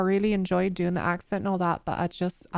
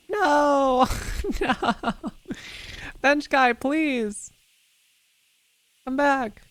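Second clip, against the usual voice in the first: mock despairing cry; 7 semitones higher, much slower than usual. Lost his bench, calls after it.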